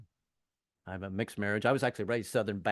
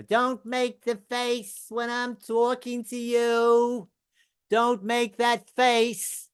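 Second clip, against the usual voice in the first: angry wife; 12 semitones higher, 6 dB louder, much slower than usual. Retold or imitated. Wife scolding in an argument.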